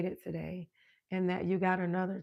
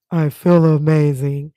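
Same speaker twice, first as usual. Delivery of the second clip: low, very loud, strained boom; 5 semitones lower, 17 dB louder than usual. Shown as a demonstration. Host shows off her returned voice, booming loud. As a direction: belt it out to show the voice is back. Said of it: "And I got my voice back".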